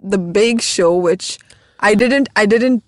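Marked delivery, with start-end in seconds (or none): none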